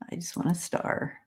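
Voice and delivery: a soft whispered aside to herself. Real, not acted. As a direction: whisper it under your breath. Muttered aside while reading comments.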